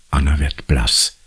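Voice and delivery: ordinary delivery, nothing marked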